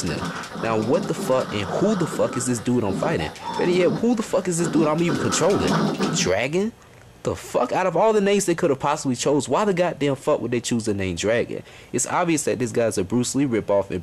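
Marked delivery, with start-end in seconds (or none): none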